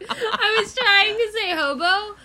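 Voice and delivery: slow, high, laughing through the words. Real, not acted. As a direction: laugh helplessly while explaining. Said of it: "laughing explanation".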